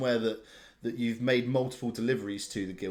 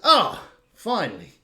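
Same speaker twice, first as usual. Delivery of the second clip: put-on louder, higher, relieved hello to an old friend; relieved greeting; 5 semitones higher, 6 dB louder than usual. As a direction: burst out glad to be rescued. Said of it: "and is like, says".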